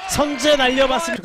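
loud, high-pitched play-by-play; excited attack call. Real, not acted. Commentator following an attacking shot, excited and loud. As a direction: lift the voice sharply on the attack.

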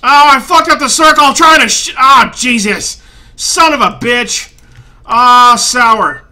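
real exasperated yelling; angry outburst. Real, not acted. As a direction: blow up at your own mistake. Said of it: "so angry".

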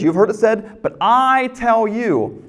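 slow "but I tell you", with the "I" leaned on hard; preacher punching "I" in "but I tell you"; stress on I. Shown as a demonstration. Preacher repeating Jesus's phrase.

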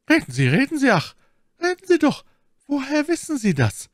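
very high, loud, insistent pleading; urgent demand. Acted. Milady demands how he knows, urgent and high. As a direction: press hard for an answer, voice soaring. Milady presses him for the secret.

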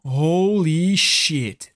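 very slow, stretched-out exclamation; stunned disbelief. Real, not acted. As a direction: draw each word out in awe. Stunned after the raid.

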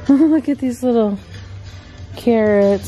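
ordinary talk

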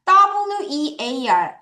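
letters spelled one by one, very slowly. Shown as a demonstration. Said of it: "끊어서 발음을 해볼게요". (한 글자씩 아주 천천히 끊어 읽기)

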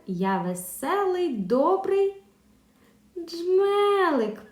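high, sing-song riddle reading; lively riddle voice for young pupils; riddle recital. Acted. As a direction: read the riddle brightly to children. Teacher reads riddle aloud.

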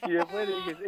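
normal talking voice

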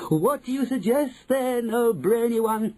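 high, eager chorus voice; witches crying out. A narrator's reading. Witches asking the brainy one, high cry. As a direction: cry it high to your leader. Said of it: "they cried out".

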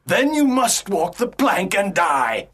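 pirate villain's wrathful sentence; mildly angry menacing captain; angry Captain Hook. Acted. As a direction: condemn them with a touch of fury. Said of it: "Captain Hook is angry".

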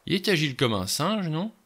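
hint at something with a strange tune; odd intonation. A narrator's reading. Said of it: "Sa voix avait une intonation bizarre".

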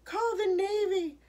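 loud, high frantic plea; hammed-up panicked storybook cry. A narrator's reading. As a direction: raise the alarm at full voice. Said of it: "she cried".

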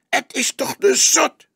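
put-on young detective protesting, fierce; fierce cry. A narrator's reading. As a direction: fire it back. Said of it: "riep hij fel".

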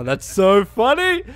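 loud, high, over-the-top mock amusement; hammed-up fake enthusiasm, climbing high; sarcastic hilarity. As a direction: pretend it's the funniest joke ever. Mocks character's lame joke.

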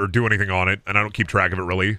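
ordinary talk

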